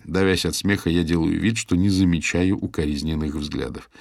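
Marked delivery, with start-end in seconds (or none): none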